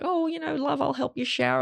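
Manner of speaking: high, lightly sweet mom voice; imitating mom. Mom offering to help her shower in a high, lightly sweet voice.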